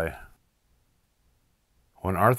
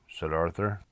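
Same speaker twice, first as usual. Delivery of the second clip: ordinary delivery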